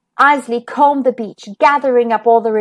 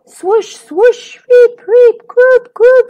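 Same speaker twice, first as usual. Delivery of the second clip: high, loud playful sea sounds; playful sea noises; waves and whale song; 10 semitones higher, 5 dB louder, slower than usual. A narrator's reading. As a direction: sing out the calls of the whales. Imitating waves and whale songs.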